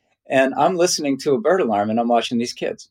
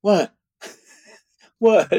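high-pitched mock startled "what"; dazed surprise; 9 semitones higher than usual. Kids at a bird workshop snapping out of their daze, mock dazed surprise. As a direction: imitate kids belatedly waking up, voice jumping high. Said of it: "waking up out of their daze".

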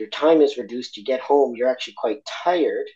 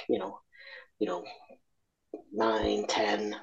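usual speaking voice

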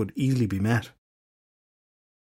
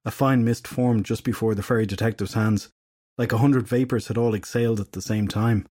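usual speaking voice

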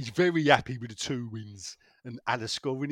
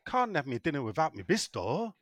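usual speaking voice

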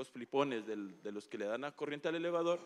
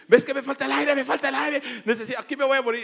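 high, loud panicked cry for air; panicked yelling; 10 semitones higher, 12 dB louder than usual. Retold or imitated. Man trapped in a lift yelling to his boss, panicked. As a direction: shout it like you can't breathe. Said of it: "yo empecé a gritar y le dije".

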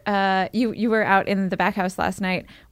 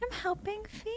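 put-on high, much softer little-kid voice; toddler voice; 12 semitones higher, 16 dB quieter than usual. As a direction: say it small and proud like a toddler. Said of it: "And he goes".

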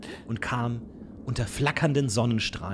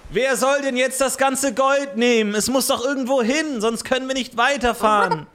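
frantic sailor voice; 12 semitones higher, 5 dB louder than usual. Acted. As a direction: shout it like a desperate sailor. Sailors ask again.

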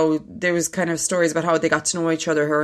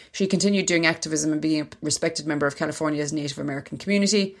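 reading aloud. Acted. Reads an article aloud.

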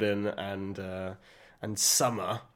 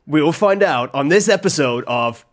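true-crime show intro, louder; mock crime narrator; 5 dB louder than usual. Introducing their parody crime show, louder dramatic announcer. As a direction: announce it like a dramatic episode teaser. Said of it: "can I do a statement".

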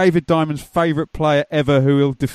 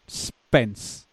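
quiet, low, sorrowful murmur of the name; mock mournful murmur; 4 semitones lower, 6 dB quieter than usual. Fan alone after a loss, murmuring the name. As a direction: sigh the name to yourself, softer and lower. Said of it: "I just kept going".

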